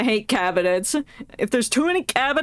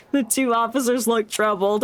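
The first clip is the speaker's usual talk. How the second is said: ordinary talk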